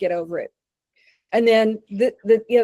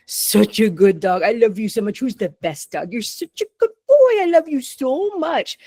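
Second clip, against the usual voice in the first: gushing dog praise. Shown as a demonstration. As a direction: coo over the dog like a doting owner. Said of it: "verbal praise with eye gazing".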